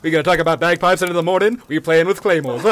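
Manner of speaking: a light mock Scottish accent, playful; lightly put-on Scottish brogue, teasing a friend; hammed-up Scottish accent. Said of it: "We're doing Scottish".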